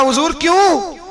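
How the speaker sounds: high, startled "why?"; a pleading, startled query; surprised question. Retold or imitated. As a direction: ask in sudden disbelief. Widow asks the caliph why.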